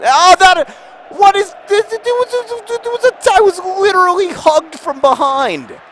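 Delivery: high, loud stammering outrage; very high, indignant wail; outraged protest. Real, not acted. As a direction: sputter at the referee at the top of the voice. Protests a foul in game.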